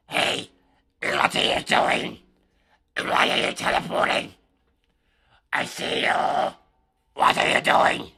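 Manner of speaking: loud whisper, slow and menacing; whisper-shout at a cheater like a lurking stalker; loud, slow whispered taunt to a teleporting hacker; creepy hacker-hunting voice. Shown as a demonstration. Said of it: "I don't even know what voice I would do".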